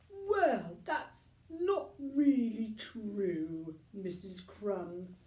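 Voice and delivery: gently but firmly call her out; Mister Stink correcting. A narrator's reading. Tramp contradicting Missus Crumb on air, slow and firm.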